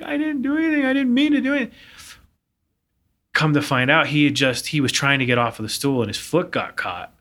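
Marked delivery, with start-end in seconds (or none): 0.0-1.7 put-on high whiny child's protest; lightly voiced crying boy. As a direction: plead like a tearful little boy. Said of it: "He starts crying".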